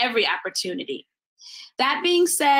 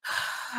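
weary sigh; 12 dB quieter than usual. Acted. Insomniac sighs.

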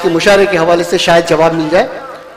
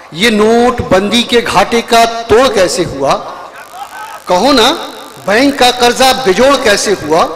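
sung-out verse with rising line ends; poetry recitation; 5 semitones higher, slower than usual. Acted. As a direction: recite each couplet to the crowd. Poet recites verse at mushaira.

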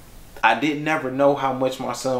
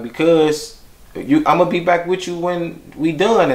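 higher, lightly voiced little boy; young son's voice; 8 semitones higher than usual. Father voices young son's reply.

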